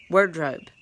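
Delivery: "wardrobe" repeated as "word-robe", first part stressed; coined word pun. Shown as a demonstration. Repeating the pun for listeners, "wardrobe" pronounced "word-robe". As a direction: hit "wardrobe" as "WORD-robe", rhyming its start with bird. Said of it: "she says wardrobe".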